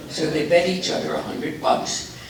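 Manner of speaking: normal talking voice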